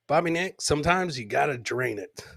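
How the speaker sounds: usual speaking voice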